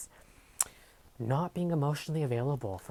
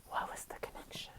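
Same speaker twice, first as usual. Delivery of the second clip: breathy whisper to spirit; whispered question; 12 dB quieter than usual. Real, not acted. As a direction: ask the spirit softly, in a whisper. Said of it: "I have to ask for clarifying".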